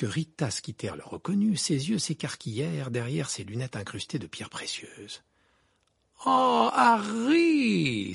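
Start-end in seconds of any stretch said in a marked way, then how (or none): 6.3-8.1 high, loud, long drawn-out cry of delight; gushing exclamation. A narrator's reading. Rita greets Harry in the pub, gushing. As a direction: exclaim it with a big smile. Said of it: "s'exclama-t-elle avec un grand sourire".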